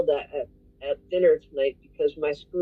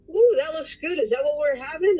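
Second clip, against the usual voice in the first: friend's eager voice; 8 semitones higher than usual. Retold or imitated. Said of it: "she goes".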